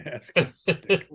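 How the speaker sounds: slow, laughing echo of the punchline; laughing repeat. Real, not acted. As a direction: savour each word through a laugh. Echoing the joke while laughing.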